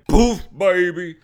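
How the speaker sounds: loud, drawn-out boom of a word; punchy mock sound effect, loud and slow; explosive gunshot imitation. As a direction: blast it out slowly like a big shot.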